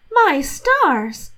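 high, loud little cry of dismay; mock shock at the mess; startled exclamation. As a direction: gasp it out as the silly wife. Catherine sees spilled ale.

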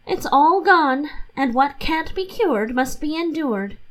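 a touch of rueful acceptance; Catherine's resigned sigh. Acted. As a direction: shrug it off in her lighter voice. Catherine gives up the steak.

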